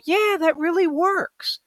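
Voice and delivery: high, sing-song fake agreement; mock agreement. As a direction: play along with a lilt. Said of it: "And you go".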